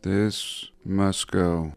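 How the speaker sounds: usual speaking voice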